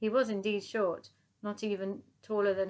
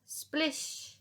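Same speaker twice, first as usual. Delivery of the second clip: ordinary delivery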